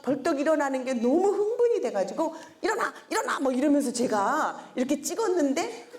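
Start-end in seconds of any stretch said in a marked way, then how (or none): 2.6-3.4 put-on high, urgent "일어나!" to a child; rousing a child, sounding bossy; mother's commanding wake-up cry; excited wake-up call. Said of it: "화가 난 사람 같은 목소리인 거예요".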